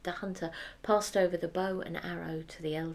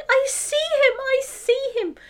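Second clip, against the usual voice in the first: put-on very high, loud old woman cry; joyful cry; 10 dB louder than usual. The old woman sees her grandson, high and overjoyed. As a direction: cry out in amazed joy.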